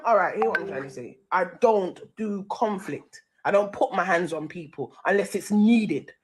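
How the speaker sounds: ordinary delivery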